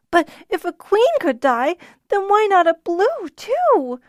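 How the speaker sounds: put-on high, rising tearful little boy; frightened boy blubbering about the dragons; blubbering child. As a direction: wail it through tears. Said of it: "Denon blubbered".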